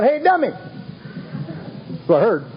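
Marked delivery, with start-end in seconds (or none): 0.0-0.5 mock yell. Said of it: "my sponsor yell out".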